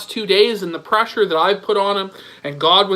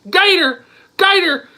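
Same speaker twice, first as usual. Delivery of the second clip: higher, pleading calls to Gator; mock plea for rescue; 8 semitones higher than usual. Begging Gator to rescue him from the segment, higher mock plea. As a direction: cry out like someone drowning, half joking. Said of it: "I'm drowning on this segment".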